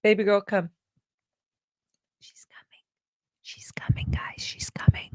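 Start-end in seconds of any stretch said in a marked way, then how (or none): 2.2-5.1 excited hushed whisper; breathless whisper to viewers. Real, not acted. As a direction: whisper so the cat isn't scared. Cat approaching, don't scare.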